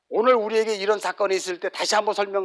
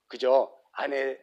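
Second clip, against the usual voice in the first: ordinary talk, nothing marked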